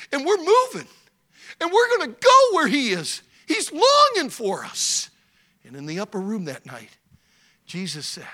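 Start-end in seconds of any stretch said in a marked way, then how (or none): 0.1-5.1 building from plain to high and loud; rising exclamation. Acted. Son Judah proclaiming to his father, building loud. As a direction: swell it to a joyful climax. Son's climactic declaration.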